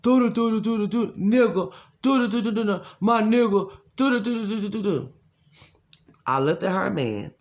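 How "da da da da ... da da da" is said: loud, high da-da babble aping her speech; exaggerated high-pitched gibberish impression; mocking imitation. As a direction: hammer out mocking nonsense syllables. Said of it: "That's all I heard".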